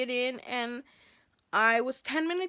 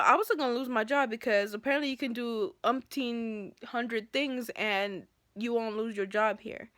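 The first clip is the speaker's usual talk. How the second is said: ordinary talk, nothing marked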